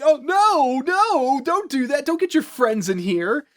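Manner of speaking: high, hammed-up protest; mock pleading. Podcaster acting the turkey seeing friends summoned, mock pleading. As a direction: beg in a put-on high voice.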